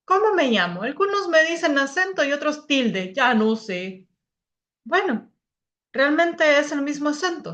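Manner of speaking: put-on little accent-mark character. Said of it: "aquí está nuestro amiguito".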